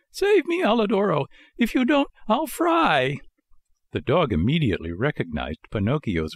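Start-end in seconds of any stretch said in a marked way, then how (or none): 0.1-3.2 very high little puppet voice, pleading; pitiful little voice. A narrator's reading. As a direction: plead for rescue in a tiny high voice. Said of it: "a pitiful little voice".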